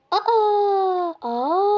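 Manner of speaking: high, loud cry of surprise; surprised gasp. Acted. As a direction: gasp at the reveal. Reveal on the couch. (şaşkın çığlık)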